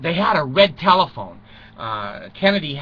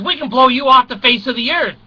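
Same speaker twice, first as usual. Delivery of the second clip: higher, louder threat; mock threat; 7 semitones higher, 4 dB louder than usual. Kennedy to Castro, a loud mock threat. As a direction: threaten them from on high.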